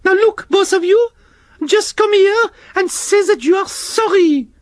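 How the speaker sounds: very high, prim scolding snail voice; Shelley the snail scolding the worm and slug, very high prim voice; French snail Shelley. Acted. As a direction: tell off two squabbling children. Shelley orders apology.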